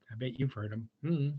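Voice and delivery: plain reading voice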